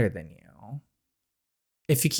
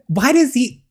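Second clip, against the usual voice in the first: indignant; 11 semitones higher, 6 dB louder than usual. Acted. Retort voiced for Daria.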